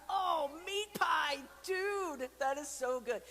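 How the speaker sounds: high, loud enthusiasm; excited. Real, not acted. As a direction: rave about it. Excited about meat pie.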